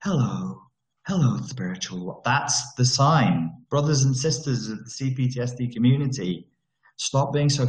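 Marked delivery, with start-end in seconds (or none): 0.0-2.2 mock serene change of voice; fake spiritual voice. Shown as a demonstration. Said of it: "when their voice changes".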